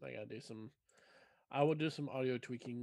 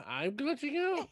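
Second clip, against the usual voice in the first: incredulous; 10 semitones higher than usual. Acted. Incredulous echo.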